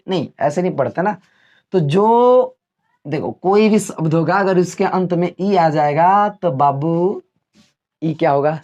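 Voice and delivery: ordinary delivery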